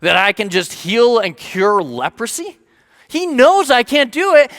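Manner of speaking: high, loud, alarmed outcry at first, then easing into worried rambling; panicked king. Retold or imitated. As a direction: play the king panicking over the letter. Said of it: "He's freaking out a little bit".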